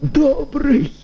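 high, slow bitter mock cheer; bitter irony. A narrator's reading. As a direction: laugh bitterly at the news. Dying man's bitter irony.

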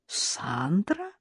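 astonished question, softly breathed out; amazed, exhaled. A narrator's reading. Karina finds her mother-in-law at home, amazed. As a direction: breathe the name out in mild amazement. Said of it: "изумлённо выдохнула Карина".

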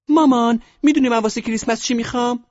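put-on extremely high, loud girl's squeak; piercingly high put-on daughter voice; little daughter. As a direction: ask Mom in a tiny squeaky girl voice. Daughter asks mother about Christmas present.

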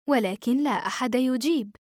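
usual speaking voice

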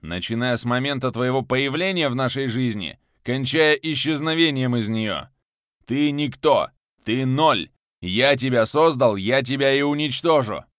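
loud, crushing menace; menacing threat. Acted. Viktor threatens to destroy the one he created, loud. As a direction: crush him with contempt.

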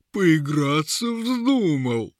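high, very drawn-out taunt; amused troll. A narrator's reading. Troll to guard with a rope, slow mocking creature voice. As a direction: tease like a dim troll, dragging the vowels. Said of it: "захохотал тролль".

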